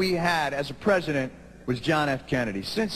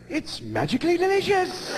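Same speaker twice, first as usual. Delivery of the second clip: higher, sing-song commercial pitch; mock commercial announcer; cheery ad voice; 12 semitones higher than usual. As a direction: sell it like a cereal ad. Mocking beer ad slogan.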